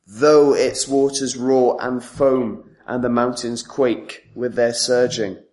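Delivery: scripture reading that leans on "though"; emphasis on "though". Real, not acted. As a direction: stretch and weight the opening "though". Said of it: "I emphasized the word".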